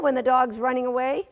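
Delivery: ordinary talk, nothing marked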